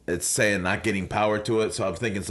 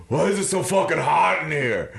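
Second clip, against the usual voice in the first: exasperated, louder and higher outburst; angry; 9 semitones higher, 5 dB louder than usual. Retold or imitated. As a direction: rant at the overheated room. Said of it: "I'm, like, angry inside".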